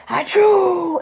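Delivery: loud, high sneeze sound; imitated sneeze. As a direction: mimic a big sneeze.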